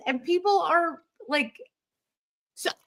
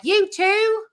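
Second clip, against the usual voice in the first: attempted Australian accent; 11 semitones higher, 4 dB louder than usual. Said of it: "I don't know how they talk".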